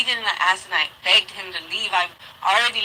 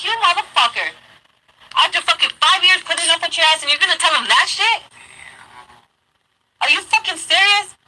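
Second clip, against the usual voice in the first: high, loud disbelieving rant; outraged cursing; 9 semitones higher, 4 dB louder than usual. Retold or imitated. Cursing the ex for lying to the cop, high outraged yell. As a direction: let the betrayal burst out up high.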